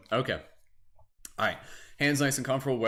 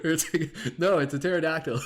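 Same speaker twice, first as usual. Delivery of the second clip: laughing; 5 semitones higher than usual. Real, not acted. Still laughing.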